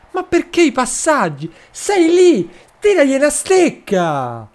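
rising exasperated shout at the player; exasperated outburst. Real, not acted. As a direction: yell at the screen to just shoot. Yelling at the player to shoot.

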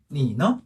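니너 with a low long 니 and a short lifted 너; 반삼성 뒤 높인 경성. Shown as a demonstration. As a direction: hold 니 low, then flick 너 upward. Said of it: "경성은 살짝 올려준다". (니너의 니는 낮게, 너는 살짝 올려서)

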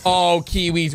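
normal talking voice

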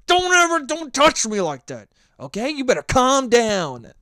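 loud, high stern warning; stern scolding. Retold or imitated. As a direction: lay down the law like a strict parent. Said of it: "SpongeBob's like".

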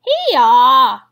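loud braying bleat; bleating sheep voice. Acted. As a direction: bray it out like a sheep. Sheep answer in chorus.

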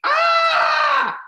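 high, loud fright shriek; scream. Retold or imitated. Demonstrating the roach reaction, a loud high shriek. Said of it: "the reaction is".

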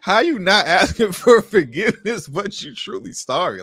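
high, drawn-out incredulous question; amused disbelief. Real, not acted. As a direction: stretch it out in baffled mockery. Mocking disbelief at played apology.

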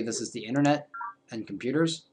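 usual speaking voice

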